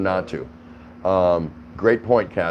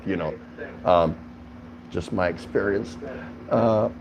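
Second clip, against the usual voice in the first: normal talking voice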